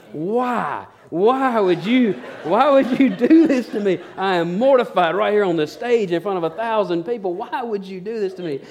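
high, hammed-up pleading; mortified brother-in-law. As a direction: act out a mortified wail from the stage. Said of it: "he is just melting".

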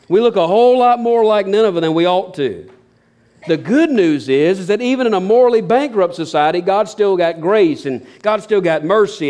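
climbing into fervent preaching; preaching at full force. Real, not acted. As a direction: preach it to the back pew.

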